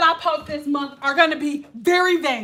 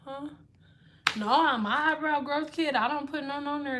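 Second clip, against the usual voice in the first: ordinary delivery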